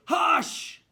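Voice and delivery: loud, high mock yell. Shown as a demonstration. Teacher showing the wrong, yelled way. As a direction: belt it out like yelling across a room. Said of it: "Or should we be yelling it".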